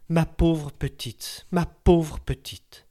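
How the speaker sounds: very slow, pitying murmur. A narrator's reading. Old neighbour pitying the young widow, slow and tender. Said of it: "reprit avec pitié".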